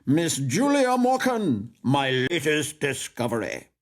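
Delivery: big, high, drawn-out showman's introduction. A narrator's reading. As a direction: announce a prodigy to the crowd, loud and grand. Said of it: "in the manner of a showman".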